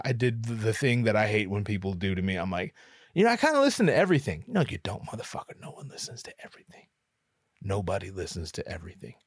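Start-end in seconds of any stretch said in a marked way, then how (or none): 3.1-4.4 rushed dodge. Retold or imitated. His evasive answer to the host. 4.5-9.2 hushed self-rebuke, dropping to a whisper; scolding whisper. Retold or imitated. Narrator's inner voice calling out his own lie, whispered.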